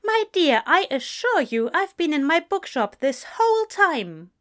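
high, fussy assurance; protesting innocence. A narrator's reading. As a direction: insist on your innocence, pitched high. Angel protesting his innocence.

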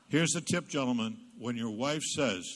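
ordinary delivery

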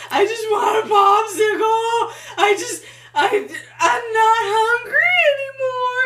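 very high, loud, slow mock sobbing; hammed-up tearful meltdown; crying wail. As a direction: bawl it out, stretching the words. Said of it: "Why am I crying?".

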